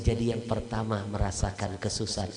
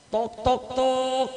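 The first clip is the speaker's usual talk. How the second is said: high, louder spoken knock knock; knocking sound; 13 semitones higher, 5 dB louder, much slower than usual. Retold or imitated. Doctor knocks at the door, voiced as loud tok tok.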